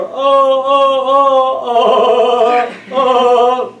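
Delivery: loud, high, hammed-up wails; over-the-top imitated wailing; mock wailing. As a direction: wail like a mother in grief. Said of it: "then she starts wailing more".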